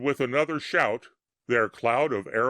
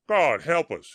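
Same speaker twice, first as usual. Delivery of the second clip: higher and louder, GOD hit hard; bellowed; 5 semitones higher, 5 dB louder than usual. A narrator's reading. Soldier bellowing to the man next to him in astonishment. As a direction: bellow it to the man beside you. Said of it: "he bellowed to the man next to him".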